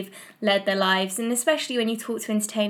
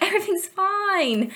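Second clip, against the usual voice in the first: stiff upper lip Brit; 10 semitones higher than usual. Pretend. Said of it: "We're just like".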